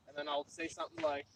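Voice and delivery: ordinary talk, nothing marked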